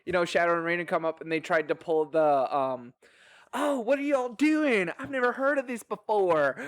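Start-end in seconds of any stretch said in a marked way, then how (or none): 3.5-6.6 mock-innocent, pitched well up; feigned innocence. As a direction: play the clueless newcomer, hammed up. Quoting visitors playing innocent newcomers.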